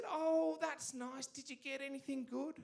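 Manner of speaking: high, sweet grandmother voice; Nana voice. Retold or imitated. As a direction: coo it like a doting nan. Nana voiced in phone story.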